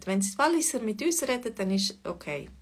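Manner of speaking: ordinary delivery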